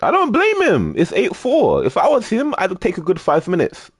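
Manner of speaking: very high, animated defence; high-pitched sympathy. Real, not acted. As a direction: argue his case way up high. Excitedly siding with losing player.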